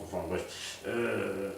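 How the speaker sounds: ordinary talk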